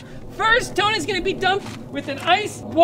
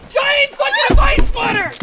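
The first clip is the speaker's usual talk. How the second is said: shouting; 6 semitones higher, 4 dB louder than usual. Real, not acted. Announcing each bucket dumped.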